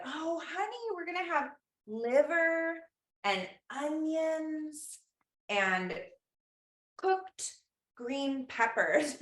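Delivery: high, sugary mother's voice, drawn out slowly; sing-song it like a mom breaking bad news gently; sweet mom voice. Mom telling her five-year-old what's for dinner, in a high sugary voice. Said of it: "And she said".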